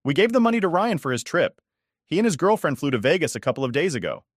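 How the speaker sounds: offhand mother breaking the news; lightly voiced mother.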